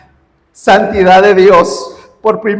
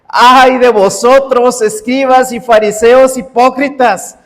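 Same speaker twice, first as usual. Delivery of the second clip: fiery scripture reading; 4 semitones higher than usual. Acted. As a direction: proclaim the woes like a prophet thundering judgment. Reads woes of Mateo 23. (lectura bíblica proclamada con fuerza)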